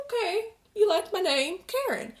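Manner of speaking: bemused reply. Retold or imitated. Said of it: "It's like".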